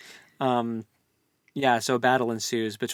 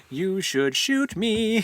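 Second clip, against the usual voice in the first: higher, slow, drawn-out taunt; higher and slow mock provocation; slow teasing dare; 9 semitones higher, 4 dB louder, much slower than usual. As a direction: dare them, stretching every word.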